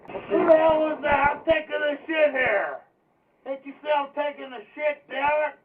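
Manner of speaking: high, loud screaming rage at the door; shrieking angry old man; furious shout. Retold or imitated. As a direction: bellow like a man caught on the toilet. Angry man yelling at intruders.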